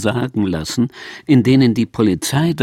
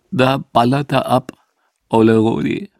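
tear-choked voice. Retold or imitated. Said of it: "sagte mit tränenerstickter Stimme".

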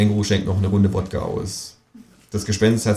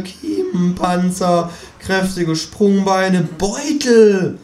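high lecturing kangaroo voice; 11 semitones higher than usual. A narrator's reading. Kangaroo lectures on evolution in a high voice. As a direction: hold the kangaroo's higher voice throughout.

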